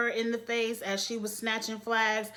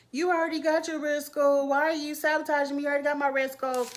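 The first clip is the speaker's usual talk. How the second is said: mock crying; 5 semitones higher, faster than usual. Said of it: "[name] at some point start crying".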